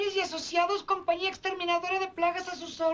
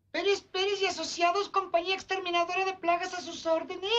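ordinary talk

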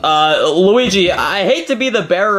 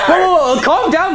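alarmed shout; 9 semitones higher than usual. Acted. Luigi grabbing Junior.

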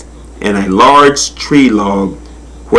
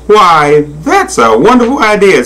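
higher, lilting sly coaxing; 9 semitones higher than usual. A narrator's reading. Crocodile to monkey about bananas, slyly. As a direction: slyly feign delight, pitch up. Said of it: "said Ajita slyly".

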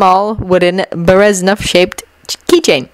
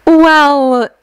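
drawn-out, higher hesitant opener; a little awkward; 7 semitones higher, 3 dB louder than usual. A narrator's reading. Haruhi answers Shinobu about the reading, a little awkwardly. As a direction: stall awkwardly on the word. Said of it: "Haruhi began a little awkwardly".

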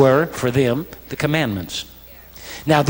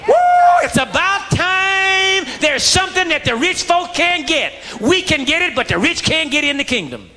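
whooping, hollering gladness. Acted. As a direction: whoop it up like poor folk finally getting one over. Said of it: "they would be hooping, hollering, glad".

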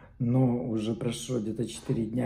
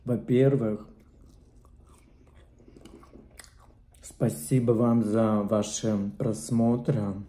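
chewing while talking; talking between bites; mouth full. Real, not acted. Seen 1: Takes bite at 1.1, chews with full mouth while talking.

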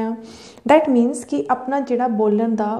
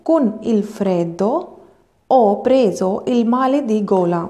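slow, careful Italian, each word apart; slow model reading; much slower than usual. Shown as a demonstration. Teacher models the Italian sentence slowly to students. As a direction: read it out slowly for the class. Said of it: "ਤੁਸੀਂ ਧਿਆਨ ਦਿਓ ਕਿ ਮੈਂ ਕਿੱਦਾਂ ਪੜ੍ਹ ਰਹੀ ਹਾਂ".

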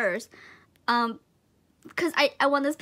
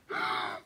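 high-pitched intake of breath; gasp, overwhelmed; shocked gasp; 5 dB quieter than usual. Real, not acted. Shaken by the gift.

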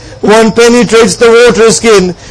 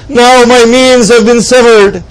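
ordinary talk, nothing marked

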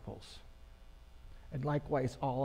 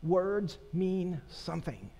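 ordinary talk

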